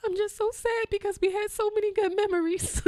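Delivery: put-on high, whiny little-boy voice; crying child. Son sobs over the old refrigerator, high whiny child. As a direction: whine it like a sad small kid. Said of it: "He said".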